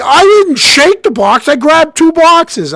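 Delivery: high, loud and slow whiny defence; indignant protest. Retold or imitated. Defending himself to laughing brother George, high and slow.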